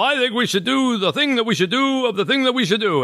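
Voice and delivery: high, fast, rambling governor babble; pompous governor. Retold or imitated. Mel Brooks governor addressing his yes men, high and rambling. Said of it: "every time he says".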